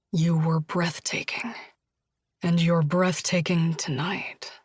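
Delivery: low, unvoiced lover's whisper. Josh whispers to Julia, low. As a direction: whisper it with awe.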